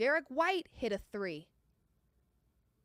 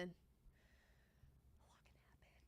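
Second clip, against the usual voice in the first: slow, breathy whisper; 4 semitones lower, 27 dB quieter, much slower than usual. Real, not acted. Bettor whispering to chat, slow hushed hope.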